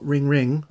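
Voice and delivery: sung-out ringtone "Ring, ring"; imitated ringtone. Imitating his phone's ringtone, light sing-song. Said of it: "it says, "Ring, ring".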